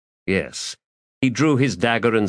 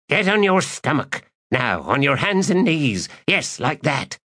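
commanding villain; 7 semitones higher than usual. Acted. Thief ordering.

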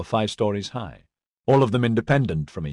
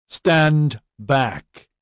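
firm, raised-voice command; measured but forceful command; loud instruction; 4 semitones higher, 4 dB louder than usual. A narrator's reading. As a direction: order them back, higher and a little louder. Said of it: "Edyard instructed loudly".

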